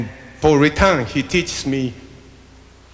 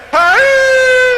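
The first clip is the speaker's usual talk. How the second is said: shouted call. Shown as a demonstration. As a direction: belt it out so the room shouts back. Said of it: "Everybody say".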